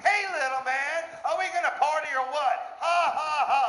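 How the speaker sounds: high mocking party-girl voice with a laugh; high teasing voice, cackling ha ha ha; mocking woman. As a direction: taunt him like a cocky woman. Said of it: "She's like".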